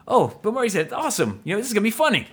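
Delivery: high, excited retelling; mock delighted reaction. Host reliving spotting Bill Murray, high and excited. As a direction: pitch it up, pleasantly thrilled. Acts out delight at seeing actor.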